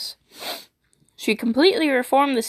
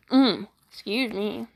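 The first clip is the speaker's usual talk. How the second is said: ordinary delivery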